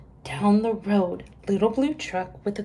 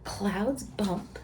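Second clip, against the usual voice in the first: ordinary delivery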